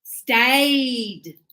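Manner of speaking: "Stayed" drawn out to a clear D; modelled -ed ending. Shown as a demonstration. Teacher correcting a student's "stay", stretched clear ending.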